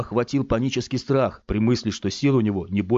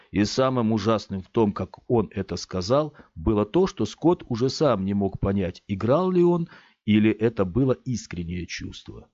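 usual speaking voice

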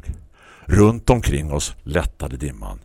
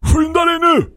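much higher, louder eager call; 5 dB louder than usual. Acted. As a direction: hurry him along from the lawn. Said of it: "ropade han istället".

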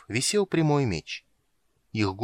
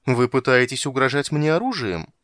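весело удивлённо; 4 semitones higher, 6 dB louder than usual. A narrator's reading. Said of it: "почти весело удивилась принцесса".

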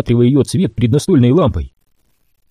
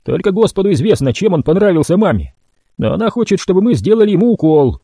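higher, gruff order; grim mutant Bodie; 6 semitones higher than usual. A narrator's reading. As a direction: tell the brother what mama wants.

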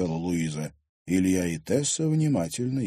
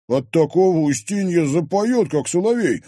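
confident; 10 semitones higher, 9 dB louder than usual. A narrator's reading. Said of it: "уверенно заявил Димка".

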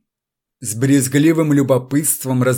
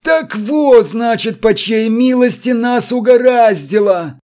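much higher, loud threatening voice; menacing fury; 10 semitones higher, 4 dB louder than usual. A narrator's reading. Electrician confronting the deputy director, threatening fury. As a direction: turn on the culprit with rage. Furious electrician finds culprit.

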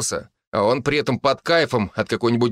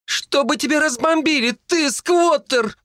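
terrified scream. A narrator's reading. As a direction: shriek in horror. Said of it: "воскликнула она в ужасе".

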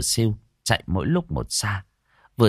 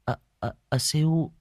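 hesitant, softer attempt to intervene; Trang, awkward; 5 semitones higher, 4 dB quieter than usual. A narrator's reading. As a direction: falter over the name awkwardly. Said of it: "bác sĩ Trang cũng khó xử". (khó xử, ngập ngừng)

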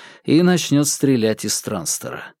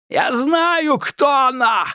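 much higher, shrill screaming madman; 13 semitones higher, slower than usual. A narrator's reading. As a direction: scream it, unhinged. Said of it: "закричал безумец".

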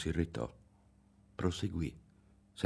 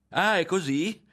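high, loud jeer; derisive, amused-sounding challenge; mocking laugh; 11 semitones higher, 7 dB louder than usual. A narrator's reading. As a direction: scoff at his bravado. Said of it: "rise di nuovo Berto a sussulti".

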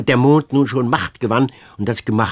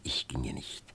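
whisper; 12 semitones lower, 16 dB quieter than usual. Acted. Girl vows defiantly, whispered.

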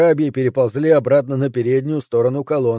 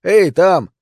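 louder, higher hail; shout; 5 semitones higher, 6 dB louder than usual. A narrator's reading. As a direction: call up to strangers on the wall. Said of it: "закричал я". (громкий оклик)

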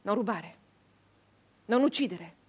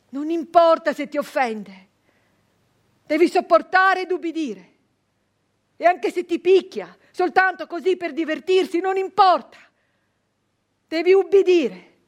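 father's stern advice; 8 semitones higher, 12 dB louder than usual. Retold or imitated. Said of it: "Questo mi diceva mio padre".

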